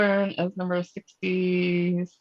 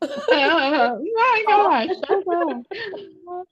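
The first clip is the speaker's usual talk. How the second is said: laughing; 10 semitones higher, 4 dB louder, much slower than usual. Real, not acted. Laughing after mock outrage.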